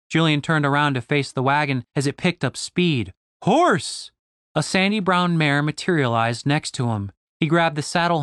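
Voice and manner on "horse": much higher called command; summoning call. A narrator's reading. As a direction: call the horse. Julian summons horse.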